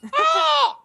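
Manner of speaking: high shrieking gull squawk; screechy seagull voice. Pretend. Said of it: "Do you wanna give us your seagull impression?".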